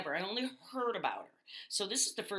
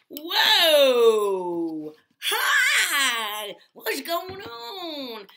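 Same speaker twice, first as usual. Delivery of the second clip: shouted whoa and hi, much higher, with a laugh; excited loud entrance; 7 semitones higher, 14 dB louder, much slower than usual. Acted. Guest making a big entrance to the host, whooping hello with a laugh.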